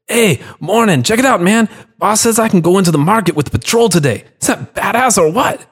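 high, bouncy, can't keep still; excited. Acted. Young watchman bragging to Carlos, high and excited. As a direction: gush like an eager kid. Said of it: "couldn't seem to keep still".

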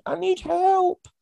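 put-on high, slow child's cry for help; a kid wailing for help, played for laughs; imitating child shouting. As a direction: mimic a little one calling from the next room. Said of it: "I hear one of my ones shouting".

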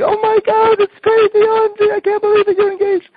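very high, loud squealing shriek; excited screaming. Shown as a demonstration. Best friend just got engaged, high excited screaming. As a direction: scream with joy at the engagement news. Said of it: "they end up screaming, yelling".

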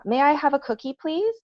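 put-on high, sweet little-kid voice rising up; polite child asking. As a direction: play the small boy asking nicely. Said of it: "my child says".

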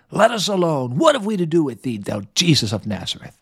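high, snarling, guttural demon voice. Acted. Teacher acting the demon to his class, snarling guttural voice. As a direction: snarl it out like a possessed man's demon. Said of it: "That's my best demon voice".

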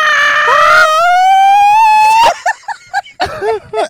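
high yippy cackling laugh; jackal laugh. Real, not acted. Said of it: "laugh like a jackal".

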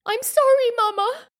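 high-pitched tearful whimper. A narrator's reading. Child apologises to her angry mother, high whimper. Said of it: "I whimpered".

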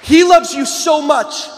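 slow, loud fervent declaration; preacher crying out God's love to the crowd; passionate shout. Real, not acted. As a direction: pour your heart out to the crowd.

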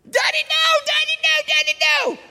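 rapid-fire high, loud protests; imitated child yelling. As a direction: shriek it like a panicking toddler. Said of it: "He starts yelling more".

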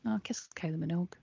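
whispered, playful humblebrag; mock hushed confession. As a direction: whisper it as a coy reply. Playing partner asked about their day.

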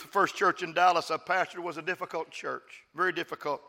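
ordinary talk, nothing marked

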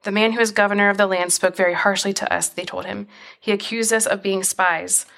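steady, measured Bible reading; scripture reading. Acted. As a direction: read the passage aloud to listeners, evenly. Reads Genesis passage aloud.

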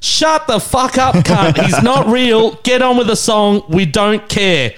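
high, drawn-out heckler's yell; retold heckle. Fan at the rail heckles preaching band, mock yell. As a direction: re-enact jeering at the band from the crowd.

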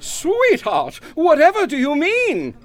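high, stagey mock bewilderment; hammed-up perplexity. Egbert to Doris, overacted puzzlement. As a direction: play innocent like a bad stage actor. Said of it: "perplexity in my voice".

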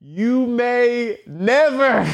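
slow, high ominous delivery; drawn-out mock warning; dramatic pause. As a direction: let it hang slowly.

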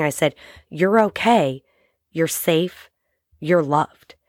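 ordinary talk, nothing marked

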